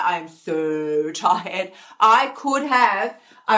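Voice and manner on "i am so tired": slow, low, drained "so tired"; sagging exhausted voice, slowed right down; weary sigh. Retold or imitated. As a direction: let the tiredness drag every word out. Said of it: "I'm like".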